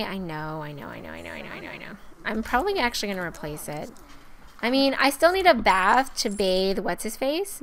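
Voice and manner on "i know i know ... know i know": low, soft repeated grumbling; quiet, low, resigned muttering; muttered concession. Acted. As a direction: mumble it under your breath, giving in. Answers the mock reproach.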